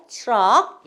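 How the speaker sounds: 트럭 as "chreok", said loud; model pronunciation. Shown as a demonstration. Teacher repeating the truck word for students, model pronunciation. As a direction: hit 트럭 hard with a ch-like tr. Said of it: "요렇게 말씀하셔야 돼요".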